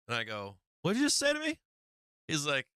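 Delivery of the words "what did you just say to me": acted-out incredulous question, voice leaping high; stunned disbelief. Storyteller replays hearing his buddy is an heir, stunned disbelief. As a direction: replay the shock in a high, rising voice. Reacts to shock revelation about buddy.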